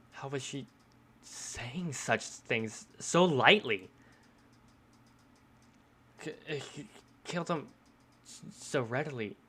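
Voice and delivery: halting, stammering disbelief; stunned character. Acted. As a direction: stumble over the words in mild shock. Voices stunned character's stammering reaction.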